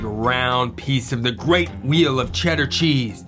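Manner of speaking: usual speaking voice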